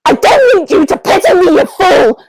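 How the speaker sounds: loud, high furious outburst; angry shout. Acted. Wilbur yelling at his friend in hurt pride, furious shout. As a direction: bark it at him in wounded pride. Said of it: "Wilbur suddenly, uh, shouts".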